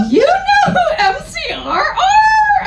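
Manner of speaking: high, loud, laughing squeal of the question. Acted. Reading the fanfic to friends, a squealed laughing question. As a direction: shriek it through a laugh. Voicing the goth narrator.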